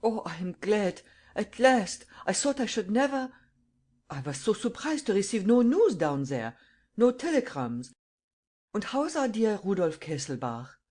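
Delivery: put-on beaming German accent, words tumbling out; strong German accent. A narrator's reading. Old German man greeting the widow, glad German accent. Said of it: "in a strong German accent".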